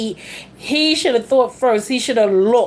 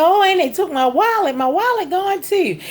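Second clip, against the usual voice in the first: higher, lightly dramatized wail of loss; mock distress; 8 semitones higher than usual. Husband finding his wallet gone, mock distress. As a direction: imitate an upset complaint, pitched up.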